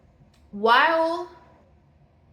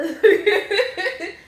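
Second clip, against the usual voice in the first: loud, high laugh bursting out; a big amused laugh; burst of high laughter; 10 semitones higher, 8 dB louder than usual. Real, not acted. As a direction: crack up loudly at the joke. Laughs at own imitation.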